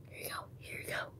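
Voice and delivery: soft breathy whisper. Real, not acted. Slipping cards into the pile, whispering softly. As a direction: hand it over in a whisper.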